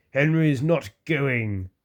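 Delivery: slow, rude refusal. A narrator's reading. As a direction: drag it out like a stubborn engine. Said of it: "said Gordon rudely".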